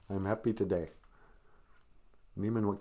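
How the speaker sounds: normal talking voice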